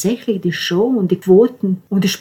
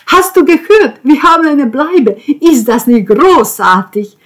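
high, loud, joyful calling out; beaming call; 8 semitones higher, 9 dB louder than usual. A narrator's reading. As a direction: call across to Simon, beaming. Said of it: "Jens strahlte und rief Simon zu". (strahlend zugerufen, hoch und laut)

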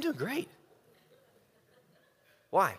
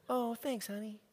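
higher, softer sweet thanks; grateful wife; 4 semitones higher, 9 dB quieter than usual. Retold or imitated. Wife thanking husband for offering help, soft and higher. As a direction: thank him softly and sweetly.

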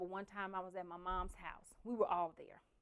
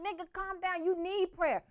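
high calming; 10 semitones higher than usual. Retold or imitated. Calming angry sister.

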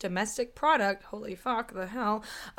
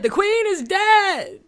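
higher, louder, slow proclamation; dramatic announcement; 12 semitones higher, 11 dB louder, slower than usual. Acted. Opening the podcast with a slow hammed-up proclamation. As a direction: drag it out like a town crier. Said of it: "this is what I'm opening with".

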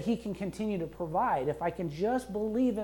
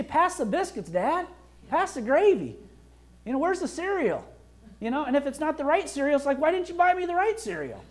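put-on much higher, demanding child voice; ungrateful kid; 9 semitones higher, 5 dB louder than usual. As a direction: play a spoiled kid at breakfast. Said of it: "they're kind of a little ungrateful".

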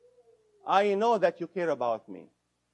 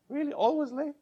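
much higher, mocking disbelief; incredulous; 12 semitones higher than usual. Shown as a demonstration. Therapist questions a partner's exaggerated blame, incredulous. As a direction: scoff at the word always. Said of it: "mocking the always".

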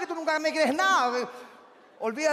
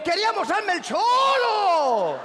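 high, slow, drawn-out cry; long stretched wail; comic horrified wail; 5 semitones higher, 3 dB louder, slower than usual. Acted. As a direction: howl the punchline in mock terror. Wailed punchline.

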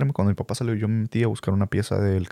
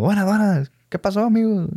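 higher, jovial guy greeting; friendly local guy; 10 semitones higher than usual. Retold or imitated. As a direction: greet them like a cheerful compa. Imita al vato saludando. (saludo de compa alegre, voz más aguda)

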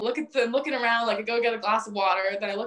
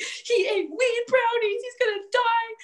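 very high, drawn-out tearful wail; mock frantic grandma; 13 semitones higher, 4 dB louder, slower than usual. Grandmother opening the door to paramedics, mock frantic wail. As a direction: imitate a hysterical grandmother, slow and shrieky high. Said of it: "opens the door furiously".

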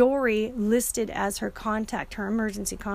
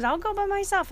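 mom insisting, voice jumping high; imitating mom; 10 semitones higher than usual. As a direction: pitch her defiant line way up. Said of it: "And she said".

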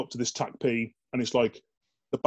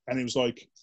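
usual speaking voice

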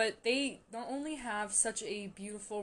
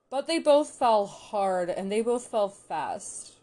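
both stretched and leaned on, slow and loud; stress on both; 8 dB louder, slower than usual. Real, not acted. Fan gushing about the couple, slow loud stress on both. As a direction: linger on the word both each time.

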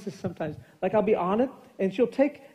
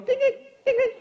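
very high "ding" chimes; iPad chime imitation. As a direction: imitate a tablet pinging in high pitch. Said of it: "I can hear my iPad in the other room going".

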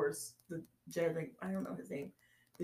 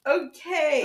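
laughing; 12 semitones higher, 10 dB louder than usual. Real, not acted. Still laughing.

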